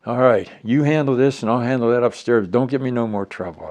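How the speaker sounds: booming, high final command; loud order. Retold or imitated. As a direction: bark the final word. Retold heated argument with colonel.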